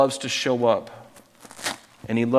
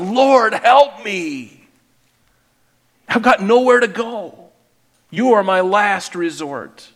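high, loud cry for help; pleading, pitched up and loud; tearful desperate plea; 6 semitones higher, 5 dB louder, slower than usual. Acted. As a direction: act out a desperate woman begging. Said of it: "with her tears coming out of her eyes".

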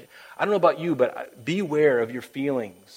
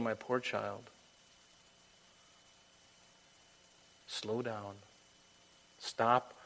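tender God, acted; 2 semitones lower, 8 dB quieter, slower than usual. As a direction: console gently, leaving long pauses. God consoling a child, acted.

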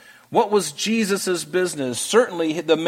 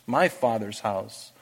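soft echo; 5 semitones lower, 6 dB quieter, slower than usual. Acted. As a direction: repeat "my Father's house" softer and slower. Softer repetition of the phrase.

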